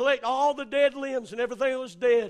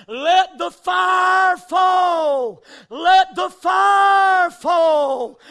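slow, drawn-out shouted chant, louder; crowd holler; 2 semitones higher, 7 dB louder, much slower than usual. Retold or imitated. Campers chanting at the mountain, slow loud holler. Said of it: "holler out in one unison voice".

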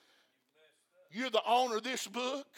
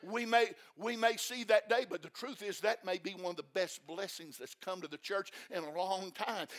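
ordinary talk

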